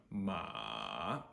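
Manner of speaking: MA dropping to a low creak, then rising; dipping third tone. Shown as a demonstration. Teacher models third tone, MA dipping low then rising. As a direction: sink MA to the bottom, then pick it up. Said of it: "drops all the way down".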